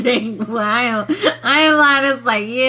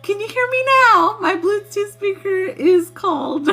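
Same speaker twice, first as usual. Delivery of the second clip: high-pitched mock little voice; squeaky tiny voice; 12 semitones higher than usual. Friend mimicking a tiny faraway caller, high and squeaky. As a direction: squeak like a tiny mic spirit. Mocking tiny whispering spirits.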